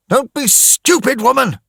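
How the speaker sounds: loud, angry shout; woman shouted loud and harsh. Acted. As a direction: snap at her, loud and fed up. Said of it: "he shouted at her".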